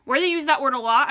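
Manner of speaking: usual speaking voice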